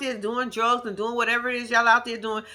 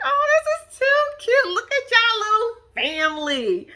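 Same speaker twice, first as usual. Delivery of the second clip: much higher, slow and gushing; gushing coo; 12 semitones higher, 3 dB louder, slower than usual. Retold or imitated. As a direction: coo over the little family. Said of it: "And I was like".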